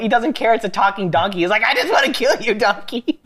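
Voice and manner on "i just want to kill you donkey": higher, hammed-up threat; mock furious Balaam. Rider threatening his talking donkey, hammed-up anger. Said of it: "he's just so angry at this point".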